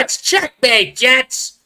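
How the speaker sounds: sportscaster catchphrases, pitched high and loud; co-host calls the play as the old announcer would, high, loud excited impression; Myron Cope. High-pitched, excitable radio call. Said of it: "He would have said".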